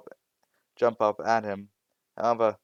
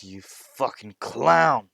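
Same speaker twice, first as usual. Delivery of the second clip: slow, loud enraged yell; furious shout; 8 dB louder, much slower than usual. Acted. Bakugo screaming at Izuku in slow, loud fury. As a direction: spit each word out in rage.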